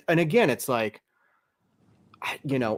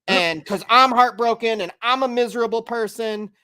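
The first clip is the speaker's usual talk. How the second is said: mock whiny sad fan; 7 semitones higher, 5 dB louder than usual. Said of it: "people that are like".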